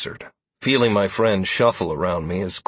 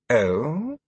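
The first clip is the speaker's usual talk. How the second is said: feigned curiosity; 5 semitones higher than usual. A narrator's reading. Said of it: "his feigned curiosity".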